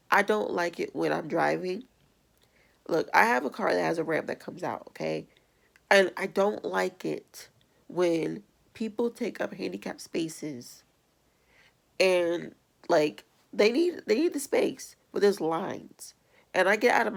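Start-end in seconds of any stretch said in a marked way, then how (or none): none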